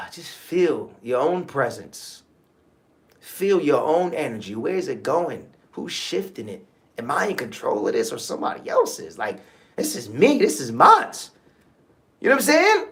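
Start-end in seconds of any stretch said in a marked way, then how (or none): 0.1-11.1 gentle self-reflection prompts, soft and low; guided meditation. Acted. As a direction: lead them inward, hushed and calm.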